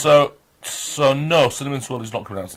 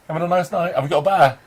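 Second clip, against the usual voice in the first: ordinary talk, nothing marked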